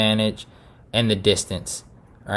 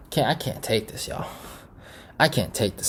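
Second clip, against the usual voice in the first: exasperated sigh; 11 dB quieter than usual. Real, not acted. Groans over Adesanya's win.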